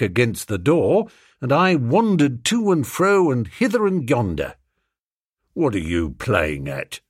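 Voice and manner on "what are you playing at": low, gruff police demand; demanding constable. Acted. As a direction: demand it like a suspicious bobby. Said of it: "demanded the policeman".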